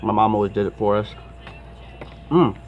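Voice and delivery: talking between bites; chewing. Real, not acted. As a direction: mouth full of chicken. Seen 1: Bites and chews chicken wing throughout; lips pursed chewing at end.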